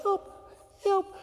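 tiny high-pitched yelp; feeble cry for help. Acted. Drowning dad too proud to yell, squeaking for help. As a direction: squeak out a stifled plea. Cannot bring himself to yell.

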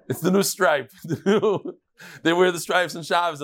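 laughing through the joke; giggling through the punchline. Real, not acted. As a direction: talk while cracking up. Joking about thieves' striped shirts.

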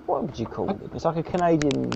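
usual speaking voice

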